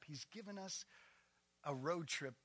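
usual speaking voice

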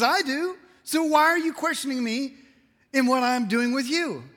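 high, loud indignant challenge; grilling rebuke. Acted. God asks why Job is questioning him, in loud rebuke. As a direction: demand an answer, pitched well up. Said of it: "God really grills Job over and over".

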